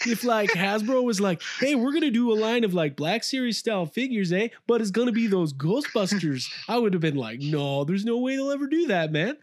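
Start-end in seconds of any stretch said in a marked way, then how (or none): 0.1-6.5 hammed-up Canadian accent, higher pitched; mock Canadian accent, higher. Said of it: "I'll just do my Canadian voice". 7.4-9.4 mock Canadian accent, higher pitched; doubtful Canadian fan, in a put-on accent. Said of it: "I'll just do my Canadian voice".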